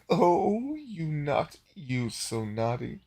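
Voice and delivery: high start gliding down; playful mock scolding; Delgar teasing. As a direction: tease it out, slowing at the end. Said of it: "Delgar was like".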